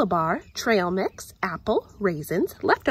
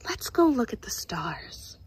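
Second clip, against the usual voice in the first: soft, breathy hush; night whisper; 4 semitones lower, 5 dB quieter than usual. Acted. Aunt whispering at night to the worried girl. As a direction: whisper so others sleep.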